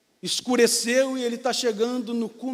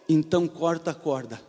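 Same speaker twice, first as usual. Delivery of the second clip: lower, calm divine voice; voice of God; 6 semitones lower than usual. Acted. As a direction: answer from above, steady and low. God's command.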